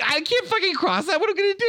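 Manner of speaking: high-pitched mock panic; panicked soldier. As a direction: play a soldier freaking out, voice leaping high. Acting terrified soldiers in trenches.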